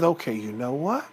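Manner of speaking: low, soft, held-in exasperation; restrained Papa Bear. Acted. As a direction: drop low and keep the temper in. Papa Bear restrains his anger.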